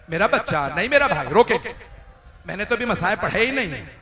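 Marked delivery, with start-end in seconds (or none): none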